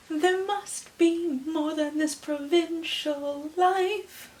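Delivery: high, very slow wistful princess voice; dreamy Belle. Acted. Reader voicing young Belle longing for adventure, high and slow. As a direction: sigh it out like a dreaming girl. Quoting Belle's song line.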